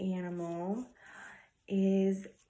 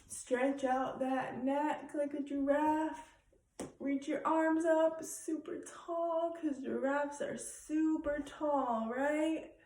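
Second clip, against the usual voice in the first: kindergarten teacher voice; 6 semitones higher than usual. Acted. Teacher stretching like giraffe.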